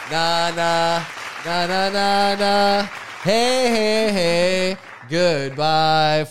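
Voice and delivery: loud taunting chant; mock crowd taunt. The crowd taunting the girls as they leave, loud. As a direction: chant them out the door. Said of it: "everyone's like".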